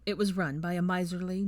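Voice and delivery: plain reading voice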